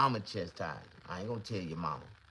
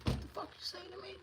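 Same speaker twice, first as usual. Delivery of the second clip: high, tight threatening demand; hammed-up intimidation, pitched way up; menacing challenge; 3 dB quieter than usual. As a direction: lean in close and demand it. Confronting the kid.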